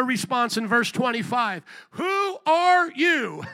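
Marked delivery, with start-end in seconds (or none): 1.9-3.4 high, drawn-out mock disbelief; exaggerated slow, high-pitched questioning; incredulous question. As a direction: stretch each word as a challenge. Acting out listeners' incredulous response.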